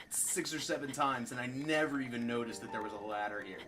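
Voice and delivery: ordinary talk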